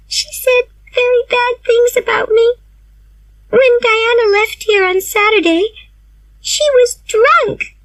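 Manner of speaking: put-on high girlish voice, slowing on drunk; a wounded girl reporting gossip, pitched well up; upset young Anne. A narrator's reading. Anne's voice in read story.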